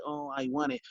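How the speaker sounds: weak, soft and high; mock lukewarm wanting; half-hearted want. Shown as a demonstration. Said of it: "And I don't mean just like".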